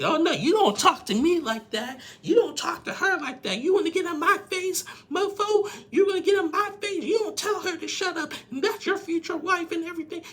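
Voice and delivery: very high, loud mock tirade; high-pitched confrontational shouting, acted out; retold friend going off. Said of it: "the friend went off".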